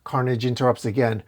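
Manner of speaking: ordinary delivery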